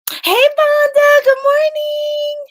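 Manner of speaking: high, slow singsong hello; excited greeting. Real, not acted. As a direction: sing out a big hello to a friend. Greeting a viewer on livestream.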